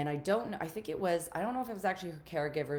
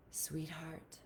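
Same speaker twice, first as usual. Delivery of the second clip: soft, soothing, with long pauses; hushed, caring questions, leaving space to feel; tender self-talk; 13 dB quieter than usual. Shown as a demonstration. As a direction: gently check in with yourself. Models gentle talk to oneself.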